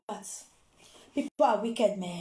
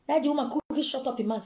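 ordinary talk

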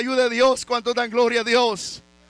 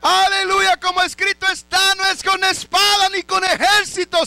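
preaching at full force; 3 semitones higher, 3 dB louder than usual. Acted. Sermon climax on God's power.

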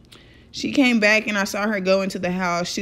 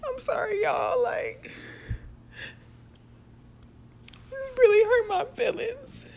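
high, tearful voice that keeps breaking; choking up, trying to get words out; crying; 13 semitones higher, 5 dB quieter than usual. Real, not acted. Vlogger apologizing to her viewers, choking back tears. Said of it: "let me calm down real quick".

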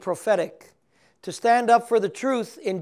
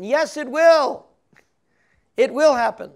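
emphatic reply; 7 semitones higher, 9 dB louder than usual. Pretend. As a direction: hammer home the certainty. God answers Moses' doubt.

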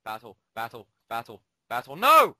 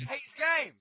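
raging; 11 semitones higher than usual. Real, not acted. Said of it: "Sorry for the rage".

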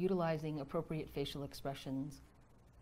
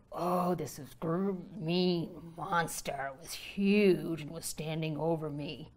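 slow, louder, dragged-out mean monster; child frightened by a dream monster; scared retelling; 7 dB louder, slower than usual. Shown as a demonstration. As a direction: make the nightmare sound big and scary. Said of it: "That really will instill fear in me".

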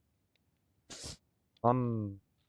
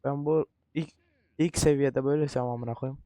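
ordinary talk

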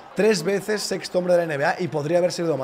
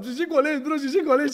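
excited; 11 semitones higher than usual. Retold or imitated. Retold thought while interviewing.

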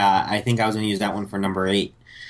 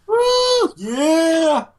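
cheering; 5 dB louder than usual. Real, not acted. Cheering the winner.

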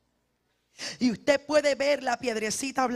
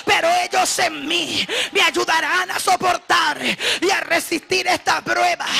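loud, higher impassioned preacher cry; fiery Spanish preaching; preaching at full force; 7 semitones higher, 8 dB louder than usual. Acted. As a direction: pour it out like a revival sermon.

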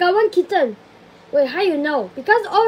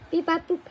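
soft sung-out dialing noises; phone dial beeps; 8 dB quieter, faster than usual. Pretend. Calling Jackie Chu, soft mock keypad beeps. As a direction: beep like keypad tones.

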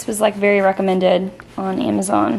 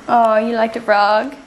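normal talking voice

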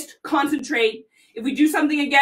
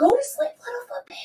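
high whispered lullaby to the foot; 10 semitones higher, 3 dB quieter, faster than usual. Pretend. Mom whispering her son's foot to sleep, high whisper. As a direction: whisper it like tucking in a baby. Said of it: "really quiet voice".

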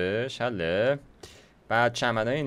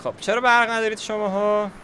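mock scolding; 10 semitones higher, 6 dB louder than usual. Scolding the park for no power.

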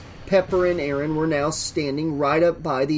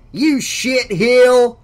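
loud, drawn-out insult, pitched up; furious shout; 9 semitones higher, 9 dB louder, much slower than usual. A narrator's reading. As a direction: shout the abuse slowly and hard. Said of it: "he shouted".

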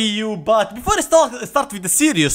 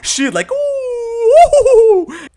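long high falsetto ooh, laughing; a drawn-out squealing moan for the joke; hammed-up high moan; mock moan; 5 dB louder, much slower than usual. Mocking a moan about the shield.

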